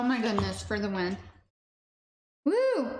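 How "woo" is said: high delighted whoop; excited whoop. Real, not acted. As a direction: cheer at the taste. Excited food reaction.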